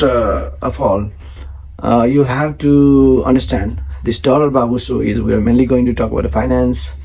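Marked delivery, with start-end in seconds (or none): none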